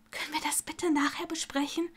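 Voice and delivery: hushed but insistent; urgent whisper. A narrator's reading. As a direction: whisper it pressingly. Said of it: "flüsterte sie eindringlich".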